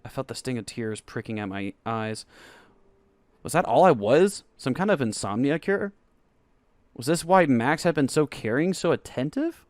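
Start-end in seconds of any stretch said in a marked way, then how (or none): 3.4-9.6 louder, higher, hurt questions; hurt disbelief. Acted. Narrator realising boyfriend used him, hurt and loud. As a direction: voice rising with betrayal.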